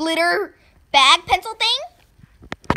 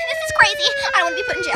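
panicky crying; 11 semitones higher, 6 dB louder, much faster than usual. Acted. Panicking about going to jail.

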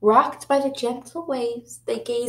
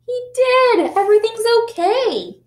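much higher, louder glad news; excited relief; 12 semitones higher, 5 dB louder than usual. Real, not acted. Teacher answering the children with happy relief, much higher and louder. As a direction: cheer for the happy ending. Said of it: "teacher relieved".

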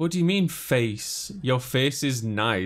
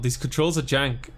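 usual speaking voice

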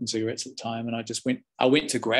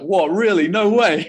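loud, higher, stunned "no way"; shocked disbelief; 8 semitones higher, 10 dB louder, slower than usual. Retold or imitated. Shocked by gossip about Gandalf.

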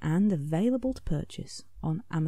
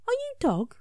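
very high small asking voice; a tiny character approaching shyly; gentle Scribble. A narrator's reading. Scribble asking the hiding Dog, very high.

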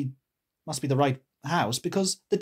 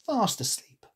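plain reading voice

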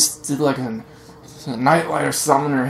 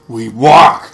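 loud sarcastic retort; 12 dB louder than usual. Real, not acted. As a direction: shout it snarky.

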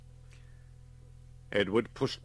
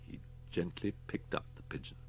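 hushed whisper; 9 dB quieter than usual. A narrator's reading. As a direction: hold your breath and whisper it. Tense moment before attack.

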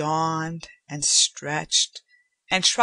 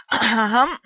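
polite louder servant's ahem; throat clearing; 5 semitones higher, 6 dB louder than usual. A narrator's reading. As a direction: clear the throat to announce someone. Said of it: "Francois said".

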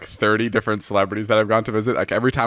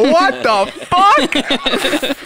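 high, drawn-out shocked squeal; stunned disbelief; 4 dB louder, much slower than usual. Retold or imitated. Reacting to a movie star on the phone, high stunned disbelief. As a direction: stretch the shock out, pitched way up.

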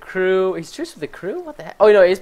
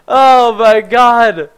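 relieved laugh; 4 semitones higher, 6 dB louder, much slower than usual. Real, not acted. Still recovering from scare.

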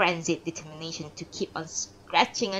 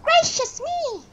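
very high, slow shocked exclamation; startled cry; 4 dB louder, slower than usual. Acted. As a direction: gasp it out in shrill surprise.

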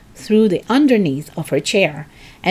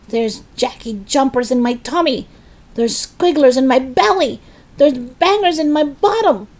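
higher, mounting protest; yelled complaint; 6 semitones higher than usual. A narrator's reading. Grandma telling George her symptoms, yelling in complaint. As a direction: list the miseries in a rising voice. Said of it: "she yelled".

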